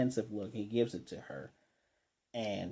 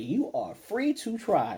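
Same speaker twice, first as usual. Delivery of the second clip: slow, higher-pitched mock dare; acted challenge; 9 semitones higher, slower than usual. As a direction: draw out a lofty challenge, retelling it. Voicing villain's challenge in recap.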